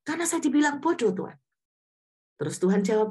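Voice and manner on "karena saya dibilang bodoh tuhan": quick, high-pitched complaint; hurt, indignant retort; aggrieved protest. Retold or imitated. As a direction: whine back in self-defence, acted. Acts own aggrieved reply to God.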